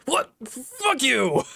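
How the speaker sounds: stuttering angry outburst, high; angry shout. Acted. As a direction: spit it out in a rage. Tough guy insulted, snaps back.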